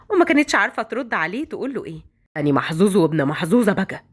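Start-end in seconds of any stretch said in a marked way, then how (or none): 2.4-4.0 Omran voiced much lower, pleased; low male voice. Omran to Hagar, low and pleased. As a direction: say it like a man proud of his luck.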